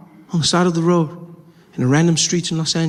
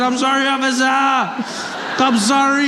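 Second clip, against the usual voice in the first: panicked pleading; 7 semitones higher, slower than usual. Retold or imitated. Pleading with the officer.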